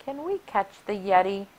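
ordinary delivery

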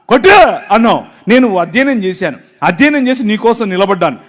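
loud, driving sermon delivery; fiery preaching. Acted. Pastor to the congregation, loud fervent preaching. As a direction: preach it hard and rising.

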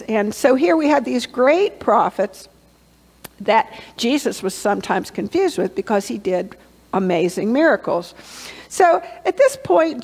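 ordinary delivery

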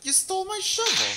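very high, loud shriek; shrieking outrage. Real, not acted. Goblin stole shovel.

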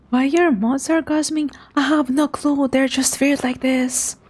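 ordinary talk, nothing marked

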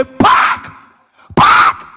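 explosive one-word shout; policeman's shouted order. Acted. Policeman stopping the comic in Lagos, shouted order. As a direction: shout it as a sudden roadside command. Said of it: "That's how policeman see me one day".

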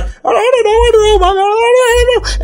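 squeaky high, helpless babble; whimper-play it in a very high voice; hammed-up helpless panic; panicked Jacob.

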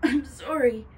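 loud, high, anguished apology; pained apology. A narrator's reading. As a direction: force it out through pain. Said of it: "pained look on her face".

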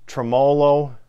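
tremolo said "treh-MOH-loh" with a long o; tremolo, the way he always says it; Midwestern tremolo. Shown as a demonstration. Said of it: "I've always said".

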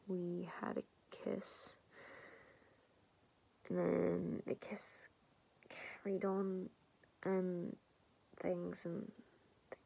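slow, soft and hesitant; awkward confession. Acted. Confessing to her best friend about the kiss, hesitant. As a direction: trail off sheepishly, with long pauses.

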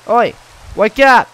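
loud, high wake-up call; shouting. Real, not acted. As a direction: yell to wake them. Calling sleeping players.

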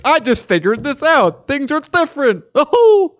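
high, laughing mock voice; mock clueless economist. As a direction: play a dim expert, cracking up.

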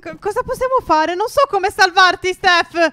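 high, dragged-out helpless cry; mock panic. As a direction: fret loudly over a sinking friend. Panicking over how to save him.

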